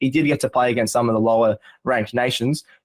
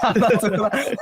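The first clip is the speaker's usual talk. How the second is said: laughing refusal; 9 semitones higher, slower than usual. Real, not acted. Refusing to do an accent.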